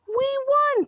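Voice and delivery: high, loud victory yelling; excited yell. A narrator's reading. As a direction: holler the news after a runner. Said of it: "shouted the boys".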